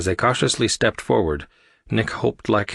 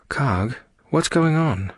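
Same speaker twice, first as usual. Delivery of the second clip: higher, slow concerned question; slow, lifted character voice; 7 semitones higher, slower than usual. A narrator's reading. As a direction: check on someone in pain. Leaning over moaning crewmate.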